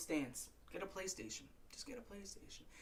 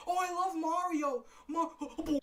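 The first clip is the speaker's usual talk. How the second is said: higher, gushing mock delight; mock fan gushing; 5 semitones higher, 4 dB louder than usual. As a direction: gush like a giddy fan. Mocking Mario fans.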